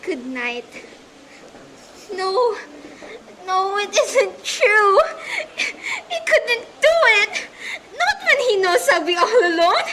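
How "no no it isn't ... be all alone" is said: slow, higher, sobbing disbelief; breaking down crying. Acted. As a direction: let the tears break through the denial. Wife learns husband left her.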